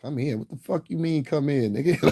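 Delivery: high-pitched incredulous comeback; indignant retort. Acted. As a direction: throw the order back as a question. Own reaction to being called over.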